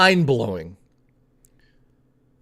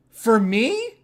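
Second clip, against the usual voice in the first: mock gasp, then a stunned For me?; astonished gasp; 7 semitones higher than usual. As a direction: play her breathless surprise. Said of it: "she goes like this".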